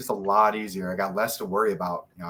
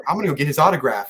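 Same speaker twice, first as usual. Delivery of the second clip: quick, louder, slightly raised imitation; mock eager girlfriend, pitched up and hurried; lightly voiced girlfriend; 4 semitones higher, 6 dB louder, faster than usual. Fighter imitating his girlfriend wanting an autograph, lightly mocking.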